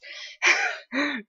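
relieved high laugh. Real, not acted. Relieved after escape.

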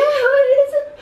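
words breaking into laughter; laughing. Real, not acted. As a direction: cracks up mid-word. Breaks up laughing mid-sentence.

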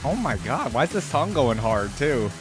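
ordinary talk

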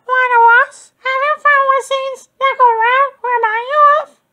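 a high, eager motherly question; mother goose voice. A narrator's reading.

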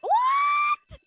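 high startled squeak of surprise; shocked squeak. Retold or imitated. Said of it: "you're gonna be like".

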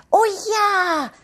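high, loud cry of delight; joyful cheer. Acted. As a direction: cheer like a thrilled little girl. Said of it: "jubelt Elfi vor Freude". (Elfi jubelt vor Freude, hoch und laut)

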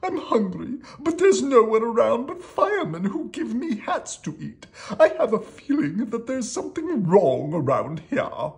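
high, loud royal whining; hammed-up high-pitched kingly complaint; complaining king. A narrator's reading. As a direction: wail it like an exasperated cartoon king. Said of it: "complained the king".

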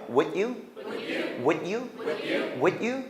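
"Would you" with a stop after would; unlinked, rising "Would you?"; cut between words. Shown as a demonstration. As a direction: halt on "Would", then lift "you". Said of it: "끊어볼게요".

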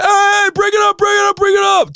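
very high, acted-out shout; urgent shouting. Frat seniors rushing in to break up the fight, shouting.

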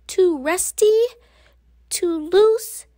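high, fretful little voice; worried child. A narrator's reading. Narrator voicing Wimberly's playground worries, fretful. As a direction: list each danger anxiously.

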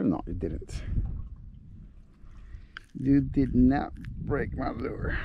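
ordinary talk

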